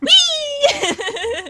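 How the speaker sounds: high, delighted squeal; gleeful whee. Pretend. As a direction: squeal with glee as it falls. Joyful cry as tree falls.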